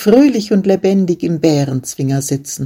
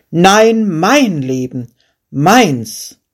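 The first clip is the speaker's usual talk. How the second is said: furious king; 2 semitones higher, 3 dB louder, much slower than usual. Acted. King argues over whose life it is, in a furious outburst. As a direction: bristle like a king who won't be contradicted. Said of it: "schlug sich der König vor Ärger".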